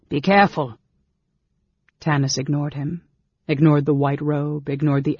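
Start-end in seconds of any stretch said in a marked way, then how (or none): 0.1-0.8 louder, urgent shout of warning; urgent warning call. A narrator's reading. Dalamar warns Tanis of hidden archers, urgently loud. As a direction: warn him before the arrows fly. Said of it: "Dalamar called".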